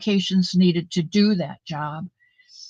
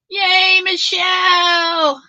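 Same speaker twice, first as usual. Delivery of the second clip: high, loud, drawn-out cheer; excited greeting; 11 semitones higher, 5 dB louder, much slower than usual. Real, not acted. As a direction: call her name out in delight, slowly. Greeting a viewer in chat.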